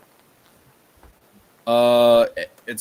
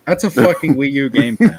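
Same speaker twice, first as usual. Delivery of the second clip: laughing; 3 semitones higher, 7 dB louder than usual. Real, not acted. Laughing disbelief at photo.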